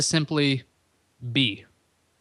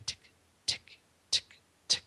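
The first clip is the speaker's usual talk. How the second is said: whispered ticking; 12 dB quieter than usual. Retold or imitated. Imitating a ticking clock.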